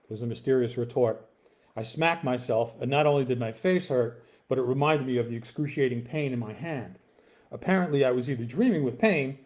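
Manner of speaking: ordinary delivery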